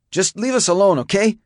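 high-pitched, upset outburst; upset yell. A narrator's reading. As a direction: push them away, worked up. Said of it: "Jacob yelled, shedding adrenaline".

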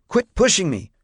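put-on high-pitched boy's protest; high, indignant outburst. A narrator's reading. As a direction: protest sharply at being shoved. Shoved boy protests to deputy.

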